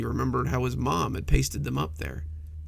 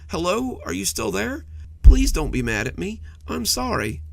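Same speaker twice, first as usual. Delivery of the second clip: higher, louder desperate begging; pleading; 8 semitones higher, 6 dB louder than usual. A narrator's reading. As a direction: plead frantically for forgiveness. Monster calling to silent Jeremy.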